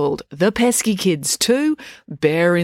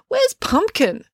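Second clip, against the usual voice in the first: anxious cry; slower than usual. A narrator's reading. April anxious about her dog.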